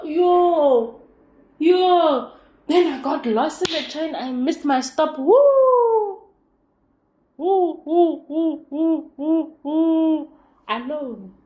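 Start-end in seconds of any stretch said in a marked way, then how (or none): 0.0-2.3 loud high yo; exclamation. Real, not acted. Stressed rider exclaiming about getting lost. 5.2-10.3 loud, high repeated woo; mock panic woo. Lost on the train, hooting in mock alarm. Reliving panic of missing stop.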